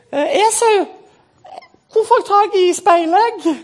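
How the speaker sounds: very high, eager-to-please voice; squeaky, meek host offering food; Jakob. Acted. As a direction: play the gentle twin high up in your range. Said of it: "han var litt sånn".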